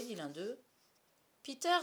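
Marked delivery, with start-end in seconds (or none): 1.4-1.8 high, loud call; breaking-news shout from a boy; excited cry. A narrator's reading. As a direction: call the leader with urgent news. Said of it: "s'écria un autre".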